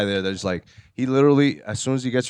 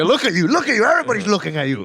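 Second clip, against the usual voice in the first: mocking taunt; 8 semitones higher, faster than usual. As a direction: rub it in his face, jeering and fast. Mocking a prankster on camera.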